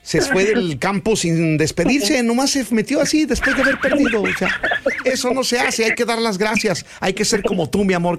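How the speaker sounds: squeaky teasing scold; high mock scolding.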